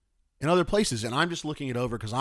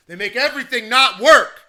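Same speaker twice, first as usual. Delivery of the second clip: higher, much louder outburst; exasperated shout; 8 semitones higher, 9 dB louder than usual. Real, not acted. Ranting about broken software to listeners, exasperated shout. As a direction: blurt it out loud in exasperation. Repeats the complaint, now shouting it.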